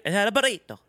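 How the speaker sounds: affected Spanish pronunciation; pretend Spanish accent. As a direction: show off a mock Spanish accent. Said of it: "pretending to be Spanish".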